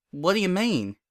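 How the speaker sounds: lightly voiced country mare; a plain, guarded farm pony; Applejack's voice.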